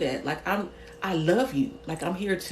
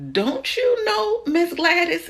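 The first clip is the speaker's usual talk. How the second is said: mock scolding; 10 semitones higher, slower than usual. Scolding Miss Gladys, high mock plea.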